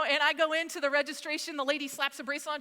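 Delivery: ordinary delivery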